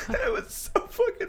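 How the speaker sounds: high, breathy laugh through words. Real, not acted. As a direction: laugh while you say it. Laughing at friend's story.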